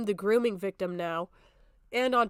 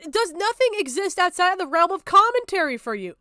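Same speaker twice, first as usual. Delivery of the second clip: loud, higher, indignant yell; angry shout; 8 semitones higher, 6 dB louder than usual. Real, not acted. Streamer ranting back at the commentator, loud indignant yell. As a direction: demand an answer in outrage.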